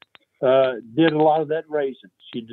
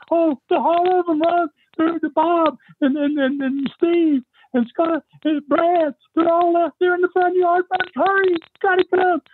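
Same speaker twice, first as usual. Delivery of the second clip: frantic, stammering, very high-pitched wife; panicking Gail. Retold or imitated. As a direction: beg for help in a high panicked voice. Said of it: "she's, she's panicking".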